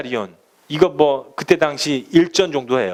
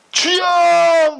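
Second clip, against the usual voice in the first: high, loud, wailing cry to the Lord; mock wailing cry; 14 semitones higher, 5 dB louder, much slower than usual. Dawn-prayer worshipper crying out loud to the Lord, mocked. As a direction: cry out to God as if sobbing. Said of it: "막 소리치면서 울면서".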